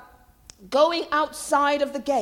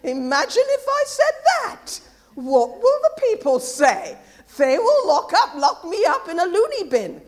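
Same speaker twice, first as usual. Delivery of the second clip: louder, raised mock-worried voice; imagined scandalised listener; 5 semitones higher, 6 dB louder than usual. Preacher voicing the congregation's thoughts, mock scandalised. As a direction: play the doubters' alarm, hammed up. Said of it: "I know what you're thinking".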